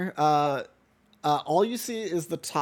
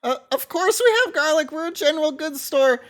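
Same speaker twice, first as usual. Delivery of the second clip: put-on Mortimer's shop-boy voice; 12 semitones higher, 7 dB louder than usual. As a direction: correct the customer meekly. Shop boy answers customer.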